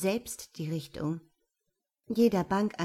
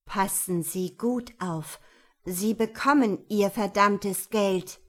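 slow, each word hit, last line louder; every word stressed; 4 dB louder than usual. A narrator's reading. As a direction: lay out each word, pressing hard on verdammtes. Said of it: "laut und deutlich, jedes Wort betonend". (langsam, jedes Wort betont, am Ende laut)

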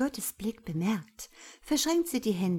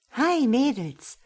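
loud, raised, drawn-out hello; breezy, mock-breathless entrance; breathless greeting; 6 semitones higher, 7 dB louder, slower than usual. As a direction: burst in with a hearty hello. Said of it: "lacht sie atemlos".

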